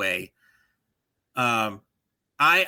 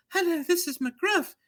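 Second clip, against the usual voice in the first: high, silly cartoon dog voice; mock the lame new crime dog; squeaky mocking crime dog impression; bad new McGruff voice. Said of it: "It's literally that bad".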